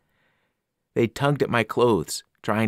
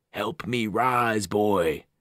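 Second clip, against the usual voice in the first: very slow and menacing; an evil thing begging to rise; hungry ancient voice; much slower than usual. Acted. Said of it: "The voice became hungrier".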